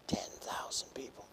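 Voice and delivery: whispered in wonder; hushed amazement at the number; awed whisper. Shown as a demonstration. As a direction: breathe it out in a hush.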